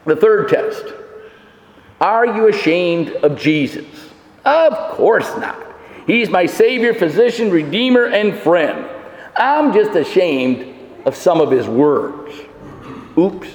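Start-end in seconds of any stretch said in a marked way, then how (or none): none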